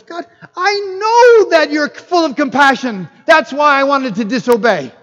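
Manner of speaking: high, loud indignant protest; hammed-up angry complaint to God; Jonah. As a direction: throw an exasperated fit at God. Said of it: "Jonah was angry that the Ninevites".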